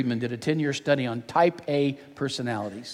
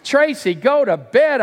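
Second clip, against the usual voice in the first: loud, high-pitched parental order; commanding; 10 semitones higher, 6 dB louder, slower than usual. Acted. Dad ordering daughter to bed, loud exasperated command. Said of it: "I commanded".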